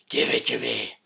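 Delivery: restrained growly demand. Acted. Batman demanding the Joker's gum, in a growl. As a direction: growl it without raising the volume.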